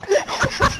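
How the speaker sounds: helpless high-pitched laugh; high burst of laughter. Real, not acted. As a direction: crack up high at the absurd line. Laughs at absurd story.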